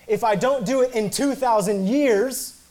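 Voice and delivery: TWO THOUSAND YEARS drawn out, high and loud; exaggerated climax. Real, not acted. Preacher topping his list of broken promises, "two thousand years" stretched out high and loud. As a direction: build slowly and loudly to "two thousand years". Absurd climax of escalating examples.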